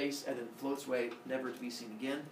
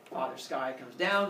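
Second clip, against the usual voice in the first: ordinary talk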